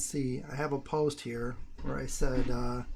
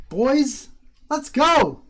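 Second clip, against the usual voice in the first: cheering; 10 semitones higher, 11 dB louder, much slower than usual. Real, not acted. Cheering price run with viewers.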